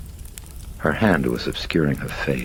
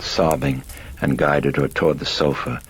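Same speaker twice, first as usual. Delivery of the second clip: ordinary delivery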